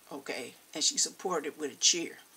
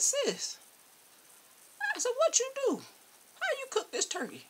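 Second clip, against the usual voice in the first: high, loud shocked questioning; incredulous; 4 dB louder than usual. Retold or imitated. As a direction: demand it high in disbelief.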